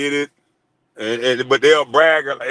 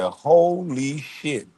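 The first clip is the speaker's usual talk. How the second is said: drawn-out, very slow disbelief; retold amazement; 4 dB quieter, much slower than usual. Reacting to overheard call, slow retold amazement. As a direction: stretch each syllable in stunned amazement. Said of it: "And I said".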